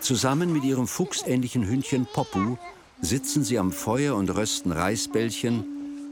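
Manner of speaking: ordinary delivery, nothing marked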